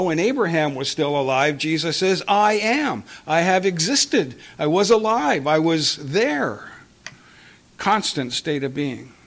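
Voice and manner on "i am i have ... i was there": rising, insistent declaration of each claim; emphatic proclamation. Acted. Preacher proclaiming Jesus' claim to the congregation, emphatic. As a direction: press each line home, slowing on the last.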